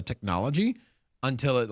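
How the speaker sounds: ordinary talk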